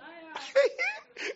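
high-pitched laughing squeal. Real, not acted. Laughs at own joke.

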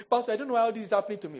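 fast, high mock complaint; mocking a complaining member; whining congregant. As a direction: rattle it off like a moaning churchgoer. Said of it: "when people say".

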